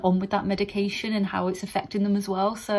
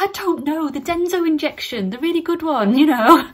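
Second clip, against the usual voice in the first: high-pitched flustered retort, building louder; comically flustered; 7 semitones higher, 7 dB louder than usual. Retold or imitated. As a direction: act out floundering for the word, ending in a laugh. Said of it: "I'm like".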